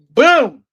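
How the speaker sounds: loud, high "boom"; punch sound effect. Retold or imitated. Storyteller acting out his friend's punch, explosive boom. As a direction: burst out with a booming punch noise.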